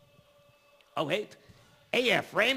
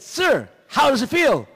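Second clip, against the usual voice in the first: loud, high, slow shout; shouting over cotton earplugs; shouting at a deaf man; 10 semitones higher, 10 dB louder, slower than usual. Retold or imitated. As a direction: yell it slowly so he hears. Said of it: "I said".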